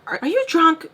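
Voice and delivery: high, incredulous mom question. Acted. Mom baffled by daughter's call, incredulous question. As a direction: pitch it up in disbelief.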